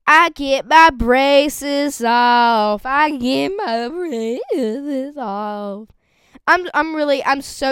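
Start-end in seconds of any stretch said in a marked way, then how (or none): 0.1-2.9 very slow, high and loud, every word stretched; thrilled announcement. Real, not acted. Announcing braces coming off. 2.9-5.9 drawn-out delight. Real, not acted. Repeating the braces news.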